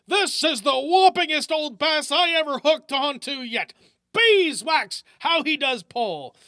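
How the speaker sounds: high, loud character voice, slowing at the end; excited old fisherman. Acted. Old Jones fighting his fish, thrilled and loud. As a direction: crow with delight over the big catch. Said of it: "gasped Fisherman Jones".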